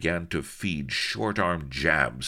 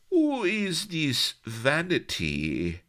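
put-on high, slow, accented question; high slow playful accent; coy Spanish lady; 12 semitones higher, slower than usual. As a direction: tease him with a tilt.

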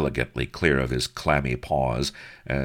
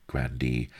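ordinary delivery

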